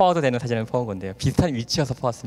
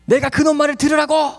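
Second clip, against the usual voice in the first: father's outraged high shout, louder; indignant father; 14 semitones higher, 7 dB louder than usual. Retold or imitated. Angry father snapping at his son's advice. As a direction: bellow it like an insulted old man.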